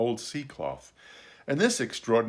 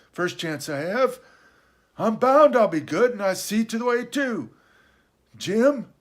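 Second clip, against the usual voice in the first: high, loud castaway, earnestly pious; Ben Gunn; 11 semitones higher, 6 dB louder than usual. Acted. Castaway confessing his fall and return to piety, high and earnest. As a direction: eccentric sea-dog rambling at high pitch.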